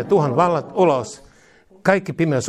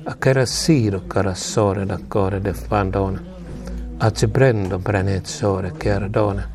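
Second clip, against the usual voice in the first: hushed glossolalia blessing; 6 semitones lower than usual. Acted. Low prayer in tongues.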